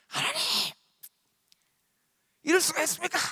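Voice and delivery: very high, strained mock outburst; Jonah's angry outcry. Preacher acts out Jonah raging at heaven, angry outcry. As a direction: cry out in protest, hammed up. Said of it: "막 소리 질렀을까요".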